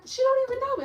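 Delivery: high, indignant patient voice; offended patient. Retold or imitated. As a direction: sound wounded and put out. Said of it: "And they be like".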